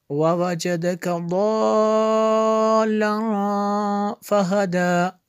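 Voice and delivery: connected, melodic Quran chant with long madds; hadr recitation. Shown as a demonstration. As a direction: sing the verses through in flowing recitation. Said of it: "Haider में देख लेते हैं". (हदर में रवाँ, सुरीली तिलावत)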